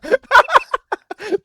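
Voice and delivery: loud, high, laughing interruption; real laughter breaking through the words; cracking up. Real, not acted. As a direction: call a halt through helpless laughter. Cracks up, stops the re-reading.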